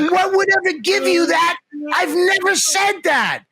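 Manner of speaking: pitched high, retold indignant protest; mock outraged disbelief. To his friend on the phone, mock outraged disbelief. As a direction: act wounded that anyone could think it. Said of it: "I go".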